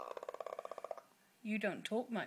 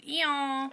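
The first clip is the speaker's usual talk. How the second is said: loud, high-pitched donkey call; donkey bray; 7 semitones higher, 10 dB louder than usual. Shown as a demonstration. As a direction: bray out a hee-haw like a donkey. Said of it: "Do the old donkey you used to".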